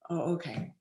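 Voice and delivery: ordinary talk, nothing marked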